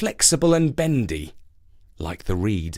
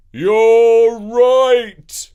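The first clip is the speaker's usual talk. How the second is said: louder and higher, called out as he is swept off; oak's last cry; 10 semitones higher, 9 dB louder than usual. Acted. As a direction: cry the words out while carried away. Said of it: "allowed to say".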